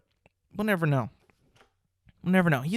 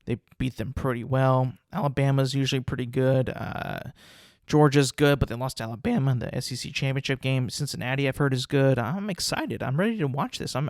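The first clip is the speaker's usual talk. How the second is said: ordinary delivery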